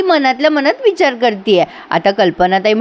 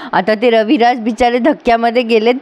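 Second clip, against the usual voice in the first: ordinary delivery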